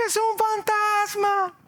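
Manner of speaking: high, slow, frightened shout of a ghost; terrified cry. Acted. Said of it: "gritaron, clamaron".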